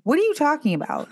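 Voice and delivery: high, incredulous question; disbelief. Retold or imitated. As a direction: ask as if he sprouted a head. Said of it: "I'm like".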